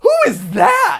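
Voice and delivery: high, loud, slow mocking of the offended shriek. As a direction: imitate her indignant scream. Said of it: "I like how offended".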